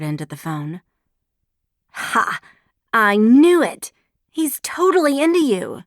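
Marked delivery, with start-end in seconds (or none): 1.9-5.8 loud, gleeful I knew it; a loud gotcha cry; triumphant. A narrator's reading. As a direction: crow it out in triumph. Friend triumphant over the kiss.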